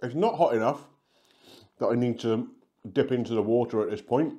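talking between bites; slightly muffled by food; chewing pizza. Real, not acted. Seen 2: Holds pizza slice, lips closed between phrases, seems to chew while talking.

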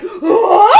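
loud rising what; mock crowd gasp. The congregation reacting to the pastor, loud rising shock. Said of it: "And everyone's like".

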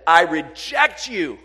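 loud, slow, heavy declaration; booming rejection. Retold or imitated. Preacher booming the Father's words of rejection, loud and slow. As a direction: thunder it down as the Father. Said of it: "when the Father said".

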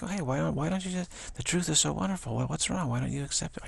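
hammed-up naive enthusiasm; mock bewildered pleading. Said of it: "you'd think".

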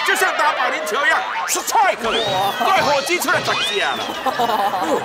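high, loud mock tirade at the clerks; ranting angry driver. Angry driver yelling at road office clerks, hammed-up high shriek. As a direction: shriek the abuse in a hammed-up fit. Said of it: "他说".

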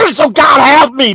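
normal talking voice

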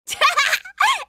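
high, delighted little giggle. Acted. Girl amused by the bells, high giggle. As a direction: burst into a quick high giggle.

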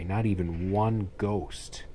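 ordinary delivery

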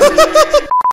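high-pitched giggling burst; high laugh. Real, not acted. Laughs at failing to enter.

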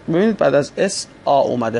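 ordinary delivery

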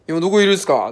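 put-on impatient customer with a Kansai accent. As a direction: Kansai customer calling the driver, pushy. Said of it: "なんか大阪弁だったっすね".

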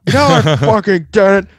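high, loud, slow mock yell; mock screaming caller. Livid caller denying, high loud mock yell. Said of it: "screaming into the phone".

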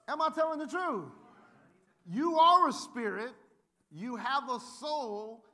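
loud, slow, rising sermon delivery; preaching at full force. Acted. Pastor preaching hard to the congregation, slow and loud.

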